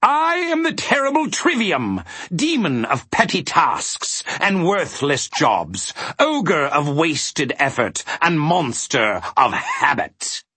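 high, slow, grandiose demon proclamation; gloating self-introduction, soaring in pitch; triumphant villain. A narrator's reading. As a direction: announce yourself with theatrical menace. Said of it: "he shrieked triumphantly".

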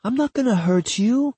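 high-pitched voice of a reassuring lover; reassuring woman. Acted. Woman reassures scared partner, high and insistent.

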